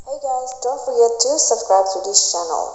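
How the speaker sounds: fast, processed mic-hog filter voice; mic hog voice effect. Shown as a demonstration. Vlogger shows viewers the mic hog filter, processed and fast. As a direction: run it through the mic hog effect, rushed. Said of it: "let's try the mic hog".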